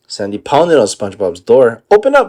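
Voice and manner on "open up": yell. Acted. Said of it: "she yelled".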